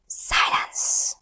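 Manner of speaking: breathy hushed whisper. A narrator's reading. Narrator hushing the listeners, whispered. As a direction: let the word fall into silence. Dramatic pause word in story.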